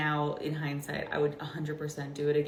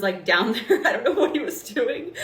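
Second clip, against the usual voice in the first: laughing; 5 semitones higher, 9 dB louder than usual. Real, not acted. Laughing about husband helping deliver.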